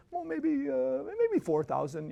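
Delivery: put-on a mild try at the driver's Indian accent, drawn out. Narrator quoting his Indian driver on yearly earnings, mild Indian accent. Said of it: "he's, with his accent, I can't do it".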